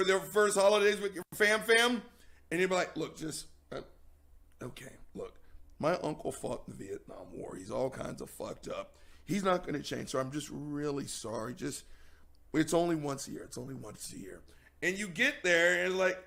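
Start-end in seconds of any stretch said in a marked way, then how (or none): 3.0-14.4 much softer, lower, hushed apology; low murmured sheepish excuse to a partner; embarrassed apology. Pretend. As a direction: mumble it like you're ashamed of your uncle.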